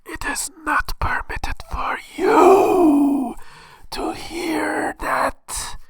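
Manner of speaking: very slow, high, whispered alien intoning; eerie whispering alien god; snake god voice. Acted. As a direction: deny him like a mysterious oracle. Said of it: "So what do they say?".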